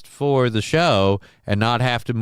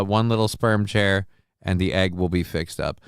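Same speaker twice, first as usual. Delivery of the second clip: ordinary delivery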